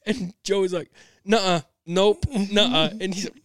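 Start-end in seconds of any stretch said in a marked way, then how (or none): 1.2-2.9 stubborn refusal. Retold or imitated. Brother refusing behind door.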